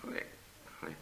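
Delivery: faint, isolated "click" after a pause; slowing clicks. Acted. Knitter's head drooping as she falls asleep, slow fading clicks. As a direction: let the last "click" die away. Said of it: "the clicks began to get slower".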